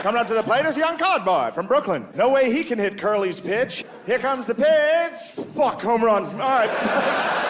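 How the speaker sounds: high vintage play-by-play, building to the pitch; old-time radio announcer. Acted. Announcer calling Jackie's home run, high vintage radio voice. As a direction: call the game like old radio, stretching the big moment. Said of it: "knocked that voice out of all the whites".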